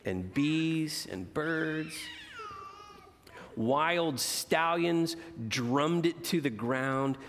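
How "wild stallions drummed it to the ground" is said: put-on expressive read-aloud to children; gentle storyteller voice for kids; storybook reading. A narrator's reading. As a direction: read it like a bedtime picture book. Reads picture book aloud.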